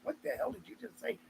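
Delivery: tiny incredulous aside; bothered listener. Retold or imitated. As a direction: mimic someone baffled, far under your voice. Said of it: "'Cause it bothers people".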